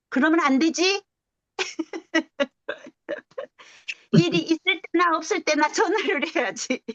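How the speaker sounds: high, laughing playful scolding; laughing mock scold. Aunt teasing sister-in-law on the phone, laughing mock scold. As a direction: tease her kindly through a laugh.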